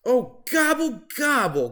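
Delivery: high, far-off turkey groan of dismay; groaning gobble. Acted. Turkey caught on the beach, groaning gobble. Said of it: "groaned Turkey".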